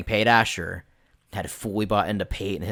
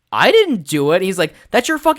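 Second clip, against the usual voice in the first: higher and louder, defensive protest; indignant protest; 9 semitones higher, 8 dB louder than usual. Retold or imitated. Player denying blame to friend, high indignant protest. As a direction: protest your innocence loudly. Players arguing after episode.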